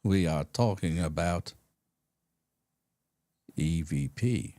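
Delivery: slow, low paranormal-show intro; mock radio host. Co-host mimics old paranormal shows opening on air, slow low mock-host voice. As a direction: imitate a ponderous late-night radio host. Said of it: "That's how it was".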